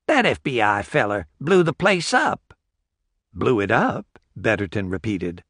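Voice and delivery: put-on old country porch-sitter. Old man telling of the blast.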